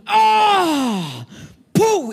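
high strained grunt, then a loud thud noise; mock gym grunt and weight slam. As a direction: mimic a show-off lifter's grunt and drop. Mimics gym guy grunting.

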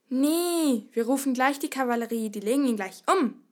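loud, forceful woodcutter's roar; bellowing. A narrator's reading. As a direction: bellow over the crowd. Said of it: "brüllte Hans". (lautes Brüllen)

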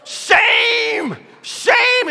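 high, loud imitated shriek; Granny Clampett. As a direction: screech it out like a scolding old lady. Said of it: "like Granny Clampett".

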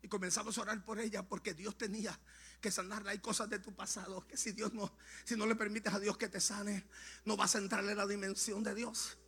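very soft, solemn storytelling with long silences; hushed testimony. Acted. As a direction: tell the painful story gently, pausing often. Hushed telling of painful disclosure.

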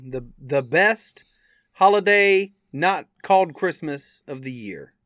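ordinary talk

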